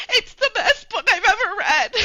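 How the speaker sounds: high, loud hammed-up wail; mock sobbing. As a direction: imitate gushing praise through sobs. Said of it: "as I'm sobbing".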